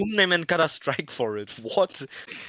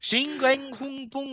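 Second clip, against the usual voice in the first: mock Chinese accent; 5 semitones higher, 3 dB quieter, slower than usual. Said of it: "That's not an accent".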